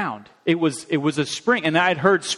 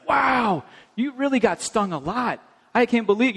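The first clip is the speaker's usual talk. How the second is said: amazed mom; 7 semitones higher than usual. Retold or imitated. Mom consoling stung child.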